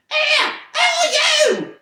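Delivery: very high, loud squeak; squeaking purse. Acted. Troll's purse squeaking as it's pulled out, loud squeal. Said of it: "it squeaked".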